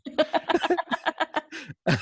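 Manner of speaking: high burst of laughter. Real, not acted. Laughs at own joke.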